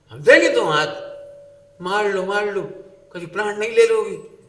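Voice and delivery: hammed-up cheeky kid; defiant retort. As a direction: shout back at the mother in defiance. Defiant child answering back.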